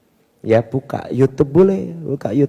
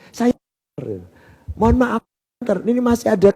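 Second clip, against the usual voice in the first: tearful man; 9 semitones higher than usual. Retold or imitated. Tearful man explains himself.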